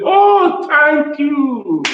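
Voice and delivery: high, loud grateful exclamation; effusive thanks. Acted. Acting out a listener thanking whoever encouraged them, high and effusive. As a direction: gush thanks on a drawn-out high pitch. Acts a grateful person's response.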